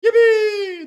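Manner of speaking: high, loud yippee; mock joyful cheer for the cat. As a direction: shout for joy like a freed worker. Cheering end of work.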